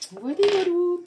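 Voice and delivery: playful sing-song. Real, not acted. Tarot reader wrapping up her warning to viewers, playful sing-song. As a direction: toss off voilà, voilà in a bright lilt.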